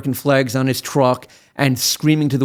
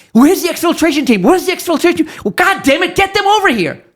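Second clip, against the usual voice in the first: very high, loud frantic yells for rescue; yelling and screaming; 5 dB louder than usual. Retold or imitated. Lawyer voicing a Marine yelling for the exfiltration team, frantic shouting. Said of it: "you're yelling and screaming".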